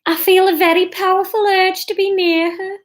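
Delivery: high-pitched put-on regional accent for Catherine; attempted Brummie. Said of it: "I'm just gonna try and read this".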